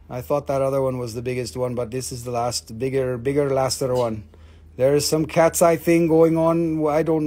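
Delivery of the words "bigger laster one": ordinary talk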